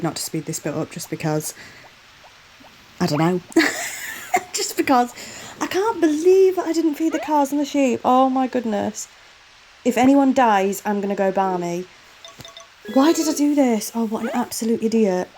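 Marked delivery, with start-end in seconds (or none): 3.5-4.5 breathy exasperated laugh, pitched way up; airy laugh. Real, not acted. Laughing at own mistake. 4.5-15.3 higher-pitched disbelief at your own mistake; exasperated at self. Real, not acted. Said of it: "Oh, I'm fuming".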